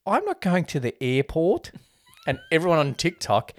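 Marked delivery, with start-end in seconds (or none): 0.1-1.7 slightly higher, imitated cartoon kid; little Bluey kid. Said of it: "one of the little Blueys".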